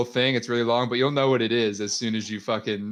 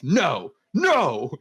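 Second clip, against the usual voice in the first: dramatic cry, second one much higher; hammed-up outburst of protest; mock furious refusal; 5 semitones higher than usual. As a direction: refuse with escalating mock outrage. Acted furious refusal in comment.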